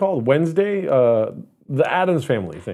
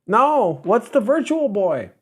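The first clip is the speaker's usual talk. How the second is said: put-on high, innocent child's question; puzzled kid; 8 semitones higher, slower than usual. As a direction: play your younger self, clueless. Acts out his puzzled younger self.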